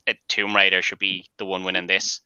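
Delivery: ordinary delivery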